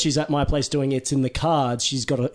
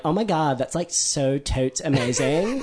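put-on Valley Girl accent, dragging out the last word; slower than usual. Shown as a demonstration. Showing her one American voice, drawling Valley Girl accent. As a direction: gush in a slow Valley Girl uptalk. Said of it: "the, the Valley Girl".

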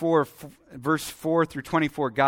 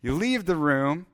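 usual speaking voice

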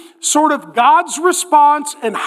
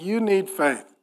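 low, quiet, unruffled answer; soft deep calm against the complaint; reply quietly, pitched well down; God; 7 semitones lower, 8 dB quieter than usual. Acted. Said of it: "And God's like".

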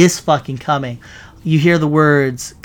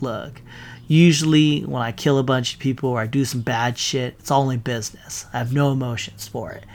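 cold, soft, unbothered Thanos; flat-calm menace, quiet; calm villain; 3 semitones lower, 5 dB quieter than usual. Retold or imitated. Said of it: "He's calm to the end".

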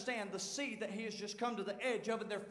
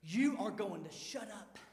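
low, hushed scolding, bitten off; softer stern command; quiet stern rebuke; 5 semitones lower, 6 dB quieter than usual. Retold or imitated. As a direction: tell them to shut up in a quiet hard voice. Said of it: "the anger of God's come on him".

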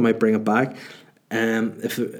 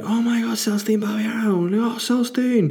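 high-pitched gushing fan squeal; mock starstruck; 12 semitones higher than usual. Friends spotting a footballer in a club, mock starstruck. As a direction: imitate lads fawning over a star.

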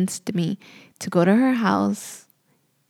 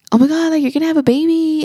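high, loud, gushing squeal; imitated excitement; 9 semitones higher, 5 dB louder, faster than usual. Sister-in-law telling her she's having a baby, imitated excitement. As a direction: imitate an overjoyed squeal, pitched way up.

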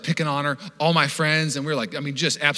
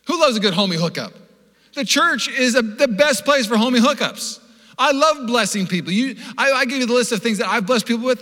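high, loud, rousing pulpit delivery; full-force preaching; 9 semitones higher, 6 dB louder than usual. Acted. Preacher rallies church around blessing people, high loud preaching. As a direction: build to a fervent church proclamation.

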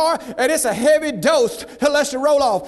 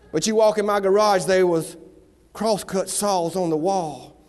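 ordinary talk

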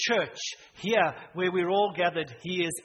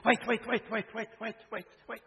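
father-in-law's quick protest; 5 semitones higher than usual. Retold or imitated. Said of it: "So he said".

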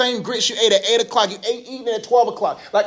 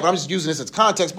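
normal talking voice

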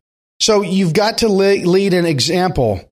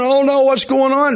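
mock worried; 8 semitones higher than usual.

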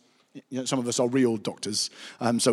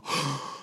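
quick quiet intake of breath; a small delighted gasp; soft gasp; 2 semitones higher, 7 dB quieter than usual. Real, not acted. Reacting to rhetoric.

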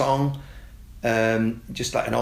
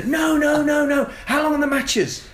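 put-on much higher and louder squealing kid; high, loud kid voice, wound up; frantic kids; 5 dB louder than usual. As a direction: kids losing patience, pleading.

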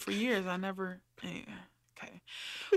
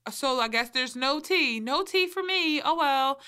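rising, louder sing-song diva; 5 semitones higher, 3 dB louder than usual. Retold or imitated. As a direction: throw shade lightly, high and airy. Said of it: "She was just like".

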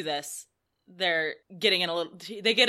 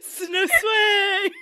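hissed with added S's, high and loud; mock hiss; 11 semitones higher, 7 dB louder than usual. Shown as a demonstration. Podcaster tries hissing Danny's line, mock. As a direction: force a hiss onto the words. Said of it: "How do you hiss that?".

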